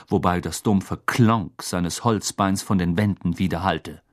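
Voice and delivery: plain reading voice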